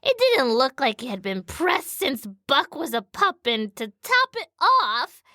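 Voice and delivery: drawling, animated storyteller with big pitch swings; sassy dog narrator. Acted. Dog star dishing on a shabby studio boss, sassy. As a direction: play up the indignant dog's tale. Reads the dog's memoir in character.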